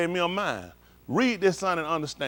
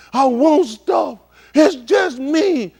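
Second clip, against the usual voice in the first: slow, loud, higher mock whine; whiny selfish voice; 7 semitones higher, 8 dB louder, much slower than usual. Preacher mocking a selfish listener, slow loud whine. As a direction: lightly voice a self-centred person.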